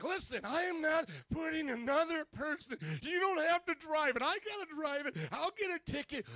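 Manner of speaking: high, loud, indignant refusal; yelling in argument. Retold or imitated. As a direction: yell back in protest. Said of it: "Glenn and I would yell at each other".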